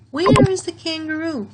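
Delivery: high, loud, eager question; where sung out high and loud; lively question. Shown as a demonstration. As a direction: ask it with big curiosity. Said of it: "So this is a question".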